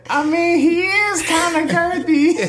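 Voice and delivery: high-pitched woman voice, slowed; woman voice impression. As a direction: squeak it up like a woman. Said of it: "Frost woman impression voice".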